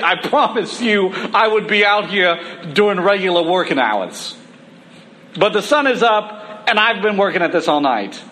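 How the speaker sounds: New York fisherman protesting; New York accent. Acted. Peter telling the preacher to leave fishing to him, New York accent. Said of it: "why I made Peter a New York".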